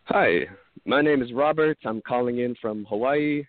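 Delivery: ordinary talk, nothing marked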